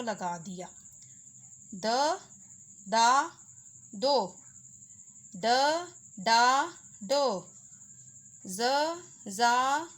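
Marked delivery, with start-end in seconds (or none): 1.7-9.9 each syllable punched out, slightly louder; syllable drill. Shown as a demonstration. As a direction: sound out the letter family for beginners. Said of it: "ये द के ख़ानदान में". (ज़ोर देकर एक-एक अक्षर बोलना)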